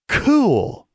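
"Cool" high and tentative like a foreign word; trying out the word. A narrator's reading. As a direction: test out "Cool" like a strange new word. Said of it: "as if trying out a foreign phrase".

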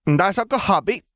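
put-on high toddler showing off toys; small child's voice. A narrator's reading. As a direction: announce it in a little boy's high voice. Toddler shows off toy starfighters.

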